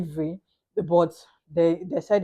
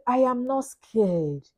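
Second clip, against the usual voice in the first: higher, slow, drawn-out insistence; insistent protest; 5 semitones higher, much slower than usual. Retold or imitated. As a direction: protest it slowly, word by word. Retells her protest to mother.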